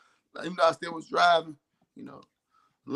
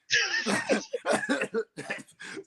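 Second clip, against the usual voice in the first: laugh. Real, not acted. Laughing at his own story.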